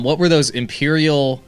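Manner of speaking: ordinary talk, nothing marked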